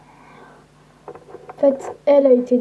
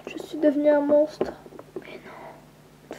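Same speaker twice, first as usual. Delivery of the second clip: désespoir chuchoté; 6 dB quieter, slower than usual. Acted.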